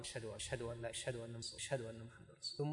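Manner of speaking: very soft, low-pitched murmur. Shown as a demonstration. Teacher shows the first quiet testimony, hushed low voice. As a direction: say the testimony softly and low, almost to yourself. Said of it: "الأولى قالها بصوت منخفض".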